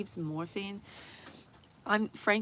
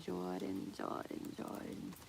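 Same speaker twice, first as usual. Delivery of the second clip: softer and softer until whispered; fading to whisper; 12 dB quieter, slower than usual. Real, not acted. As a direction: let the name fade into a whisper. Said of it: "fading repeat".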